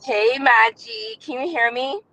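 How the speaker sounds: ordinary delivery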